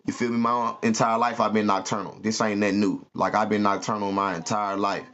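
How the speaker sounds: normal talking voice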